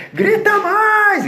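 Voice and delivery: a high, piercing full-voice yell; shouting. Retold or imitated. At the nursing home, shouting at a screaming old lady to match her. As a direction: scream it over someone's screaming.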